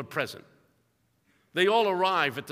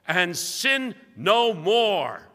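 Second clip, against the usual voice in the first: very slow, louder, weighty pronouncement; solemn command; 3 semitones higher, 6 dB louder, much slower than usual. Acted. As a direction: lay down the rule word by word. Preacher voicing Jesus' command.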